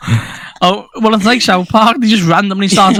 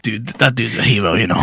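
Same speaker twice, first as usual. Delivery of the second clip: low laid-back dude; lightly voiced, low-pitched admiration; Charlie character voice; 8 semitones lower, 5 dB quieter than usual. Voicing Charlie's closing line.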